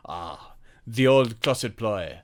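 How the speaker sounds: put-on slow, pompous French-accented line; a hint of Clouseau's French accent. Clouseau's closet line, acted out.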